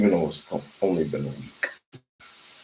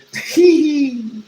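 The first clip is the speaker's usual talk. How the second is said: loud high whoop of joy; excited whooping laugh; 7 dB louder than usual. Real, not acted. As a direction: whoop with delight for the team. Whoops over his team.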